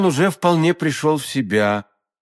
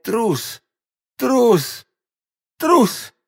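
high-pitched separate cries, climbing higher each time; fading cries; 9 semitones higher, much slower than usual. A narrator's reading. D'Artagnan collapsing in the street, still crying out. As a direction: keep crying out as strength runs out. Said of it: "продолжая кричать".

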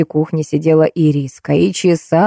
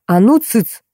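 a restrained booming command, pitched up; mild thundering order; 4 semitones higher, slower than usual. A narrator's reading. Серёжка hushing the quarrelling children, mildly thundering. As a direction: silence the squabbling with mild force. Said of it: "прогремел Серёжка".

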